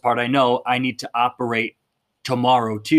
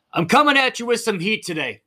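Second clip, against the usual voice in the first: higher, pumped-up announcement; fired up; 9 semitones higher than usual. Real, not acted. Coach to viewers, revved up and higher. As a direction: bring the heat, fired up. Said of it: "names own heated delivery".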